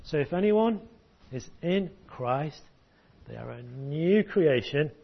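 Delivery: put-on slow, deliberate recital for the children; slow teaching demo. Shown as a demonstration. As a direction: spell it out slowly so they can follow. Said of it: "Can you do that?".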